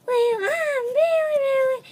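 very high squeaky voice; put-on squeaky voice. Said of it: "that's not my really real voice".

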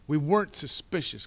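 exclaiming. A narrator's reading. Boy correcting his sister's word, loud exclamation. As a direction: burst in as an insistent boy. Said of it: "Klaus exclaimed".

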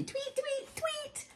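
very high-pitched little tweets; bird tweets. A narrator's reading. Storyteller voices the bird in the band, high tweets. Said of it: "A little bird tweeted".

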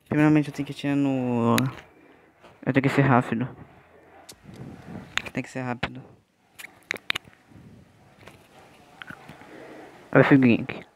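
mic pressed against the mouth. Real, not acted. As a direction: speak with the mic at your lips. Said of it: "tô com o microfone na boca".

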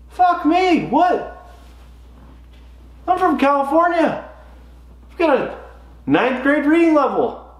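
high-pitched comic disbelief; exasperated bewilderment. Retold or imitated. Golfer failing to understand cart girl's word, high exasperated confusion. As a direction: protest your confusion in a high voice. Acting out his confusion at jangles.

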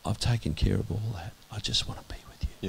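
soft, near-whispered voice of God; God's voice as a still small whisper; quiet small voice. Retold or imitated. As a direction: hush it to a gentle whisper. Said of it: "quiet, small voice".

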